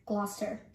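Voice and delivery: Gloucester as GLOSS-ter; two syllables only in Gloucester; local pronunciation. Shown as a demonstration. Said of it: "how do you pronounce".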